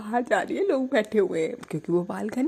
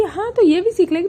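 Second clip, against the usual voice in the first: eager mom; 11 semitones higher, 7 dB louder than usual. Retold or imitated. Mother eagerly agrees.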